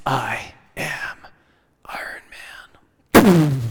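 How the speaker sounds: hushed, breathy whisper; dramatic whisper. Retold or imitated. Preacher imitating Tony Stark's final line, whispered. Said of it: "very dramatic, very Tony Stark".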